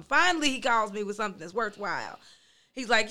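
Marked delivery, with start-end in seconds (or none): none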